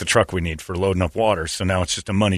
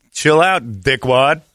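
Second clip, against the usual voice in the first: put-on a light Austrian Arnold accent, louder; Arnold Schwarzenegger accent; 4 semitones higher, 3 dB louder, much slower than usual. Radio host doing an Austrian Arnold accent for the show. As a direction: say it like Arnold telling someone off. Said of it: "only way you can say it is in that accent".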